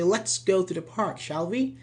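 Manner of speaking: "shall we" rising, seeking agreement; rising tag intonation. Shown as a demonstration. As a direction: suggest it, then lift the pitch on "shall we". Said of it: "we use a rising intonation".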